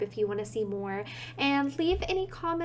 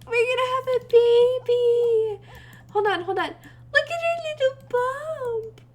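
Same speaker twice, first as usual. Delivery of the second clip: excited gushing; 12 semitones higher, 5 dB louder, slower than usual. Real, not acted. Player telling viewers the sim is pregnant, high excited gush. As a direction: gush over the news in a high voice.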